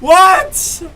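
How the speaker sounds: high, loud disbelief; a stunned, yelled "What?" going up; shocked what. Real, not acted. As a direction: blurt it out floored. Shocked by the female vocal.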